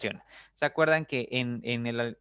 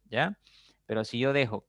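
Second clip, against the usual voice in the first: normal talking voice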